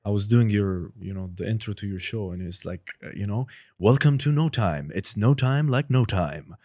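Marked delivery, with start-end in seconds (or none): 3.8-6.6 booming trailer announcer, louder and slow; movie trailer voice. Shown as a demonstration. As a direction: read it like an epic movie trailer. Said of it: "the movie trailer voice".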